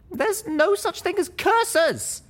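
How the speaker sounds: high, slow, loud call after her; frustrated shout. Acted. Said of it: "I called out after her in frustration".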